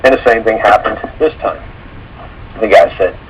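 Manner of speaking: ordinary talk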